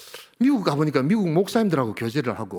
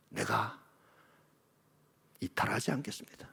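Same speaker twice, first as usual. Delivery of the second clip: whispered vow; 5 semitones lower, 12 dB quieter than usual. Acted. Quiet resolve in sermon.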